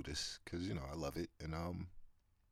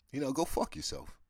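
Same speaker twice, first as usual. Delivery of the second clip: blunt dismissal; 8 semitones higher than usual. Real, not acted. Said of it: "if I'm just being honest here".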